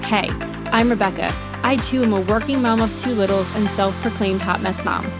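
upbeat scripted intro voiceover; bright host read over music; podcast intro read. Acted.

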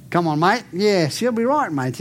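light imitation of an Australian accent; lightly imitating an Australian on a plane. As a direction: toss it off like a friendly Australian. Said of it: "talking to me like an Aussie".

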